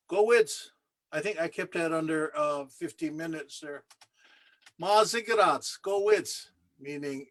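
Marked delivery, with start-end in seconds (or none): 0.1-0.7 calling out. Real, not acted. Calls out the closing phrase. 4.8-6.5 calling out. Real, not acted. Calls out the closing phrase.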